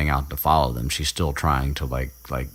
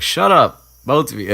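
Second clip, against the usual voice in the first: loud, high-pitched telling-off; mock exasperated snap; 9 semitones higher, 8 dB louder than usual. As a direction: snap at two bickering friends, imitating. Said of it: "Harry snaps at both of them".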